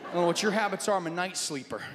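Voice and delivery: normal talking voice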